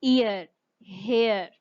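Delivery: very slow ईयर and हेयर with smooth glides; slow model words. Shown as a demonstration. Teacher modelling words for students, very slow. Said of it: "flow में glide करते हैं".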